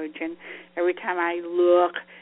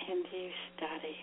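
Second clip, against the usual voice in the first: whispered; 2 semitones lower, 13 dB quieter than usual. Shown as a demonstration.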